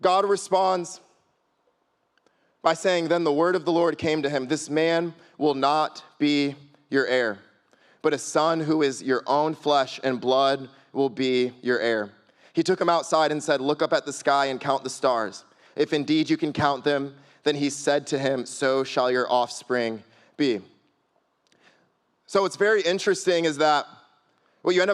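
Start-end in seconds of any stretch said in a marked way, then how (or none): 3.1-12.1 slow, measured Bible reading; lower, deliberate reading of scripture. Acted. 13.6-20.6 measured, lower-pitched Bible reading; low, solemn reading, slowing at the end; scripture reading. Acted.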